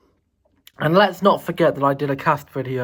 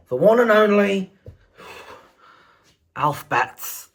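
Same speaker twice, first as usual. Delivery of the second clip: hype intro; 5 semitones higher, 3 dB louder than usual. Real, not acted. Self-announcing vlog intro.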